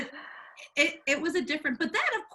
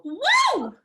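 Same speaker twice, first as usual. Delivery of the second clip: high, loud whoop of delight; a sudden delighted woo; excited whoop; 10 semitones higher, 7 dB louder than usual. Real, not acted. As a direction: cheer it out. Reacting to twelve siblings.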